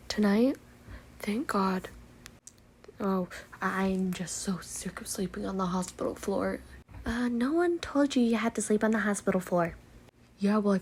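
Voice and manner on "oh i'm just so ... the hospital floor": whispered complaint. Acted. Griping in hospital room.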